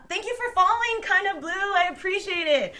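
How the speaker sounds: high, loud burst of gratitude; gushing thanks. Real, not acted. Streamer thanking a new follower, gushing.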